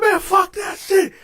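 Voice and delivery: high-pitched, indignant brush-off; angry dismissal. Retold or imitated. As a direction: re-enact refusing the excuse angrily. Retold rejection of excuse.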